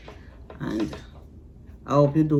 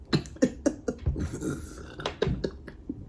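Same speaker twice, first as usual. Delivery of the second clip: giggling laugh; 10 semitones higher, 6 dB quieter than usual. Real, not acted. Laughs after a burp.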